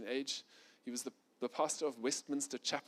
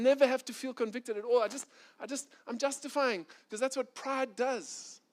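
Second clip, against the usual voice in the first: raised, louder, insistent self-defense; proud self-justifying; 7 semitones higher, 4 dB louder than usual. Acted. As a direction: argue your case like proud self-righteousness. Voicing pride's self-justification.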